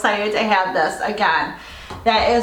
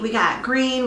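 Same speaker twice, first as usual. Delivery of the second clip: normal talking voice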